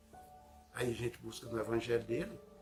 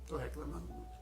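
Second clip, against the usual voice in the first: hushed, much softer admission; autocrítica baixinha; 10 dB quieter than usual. Acted. Repeat of the self-reproach. (admitindo baixinho)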